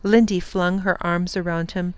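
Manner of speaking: usual speaking voice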